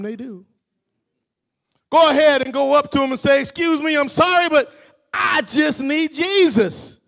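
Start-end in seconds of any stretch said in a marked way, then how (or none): 1.9-7.0 high, loud pulpit shout; fired-up preacher exhorting the church; preaching at full force. Real, not acted.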